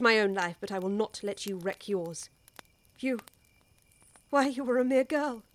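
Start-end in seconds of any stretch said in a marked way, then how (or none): none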